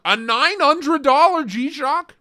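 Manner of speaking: high, slow disbelieving cry; shocked. Real, not acted. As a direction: gasp out the price in disbelief. Shocked at watch price.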